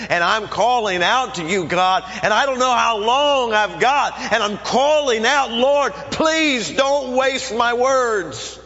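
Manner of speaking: impassioned preacher crying out for help; desperate pleading prayer. Acted. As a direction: pour it out as a last desperate plea to God.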